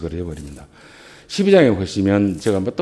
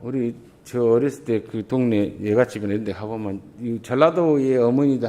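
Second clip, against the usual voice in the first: ordinary talk, nothing marked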